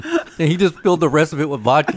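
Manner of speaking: ordinary delivery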